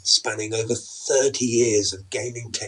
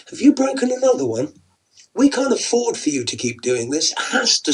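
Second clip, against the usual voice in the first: exasperated scolding; 10 semitones higher, 4 dB louder than usual. Acted. Wife scolds husband over controller.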